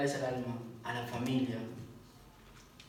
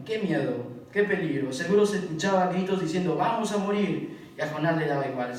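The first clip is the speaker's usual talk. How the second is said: fiery preaching; 3 semitones higher, 9 dB louder than usual. Acted. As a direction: thunder it at the congregation. Predicación a plena fuerza. (predicación ardiente, alta y fuerte)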